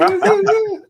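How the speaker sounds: very high-pitched squealed noise; octave-up squeal. Shown as a demonstration. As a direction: let the voice shoot way up into a squeal. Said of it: "my voice goes up an octave".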